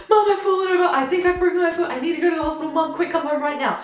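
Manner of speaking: high, loud panicked plea to mom; mock crying phone call. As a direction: act out a frantic, tearful call for help. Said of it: "crying her, at her through the phone".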